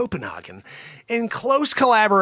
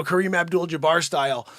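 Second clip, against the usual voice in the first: ordinary delivery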